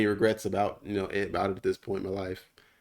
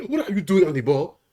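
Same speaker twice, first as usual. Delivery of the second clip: mock coach; 9 semitones higher, 9 dB louder than usual. Mocking African soccer coach.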